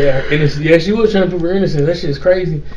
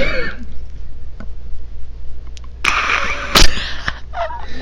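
long high, wheezy laugh; drawn-out breathless cackle; real laughing fit; 4 dB quieter than usual. Real, not acted. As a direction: crack up helplessly mid-story. Cracks up mid-story.

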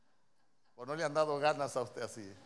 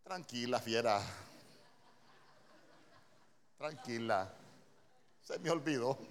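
lower-pitched, measured calming of a spouse; calm, soothing reply; 5 semitones lower than usual. Acted. Preacher models a husband answering his scolding wife, calm and soothing. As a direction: soothe an angry partner, keeping composure. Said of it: "esa tonalidad alta, ¿qué le va a decir?".

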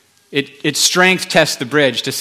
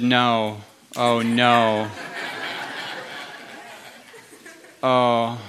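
sinking realisation; 5 semitones lower, 6 dB quieter, much slower than usual. Retold or imitated. Retold dawning realisation of fault.

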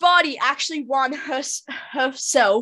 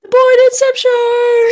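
high, loud, slow show-title cry; show title shout; 12 semitones higher, 12 dB louder than usual. Acted. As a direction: belt out the show name. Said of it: "we've done that so many times".

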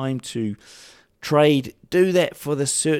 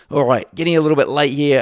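croaky voice; 7 dB louder, faster than usual. Real, not acted. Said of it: "I'm getting a croaky voice".

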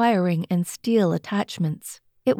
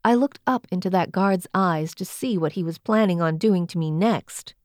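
ordinary delivery

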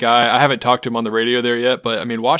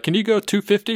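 mock controller; 10 semitones higher than usual. Controller asking speed on final.

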